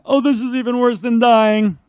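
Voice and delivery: high, lightly tearful lament; a hint of the weeping ghost; crying ghost. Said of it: "broke down and started crying".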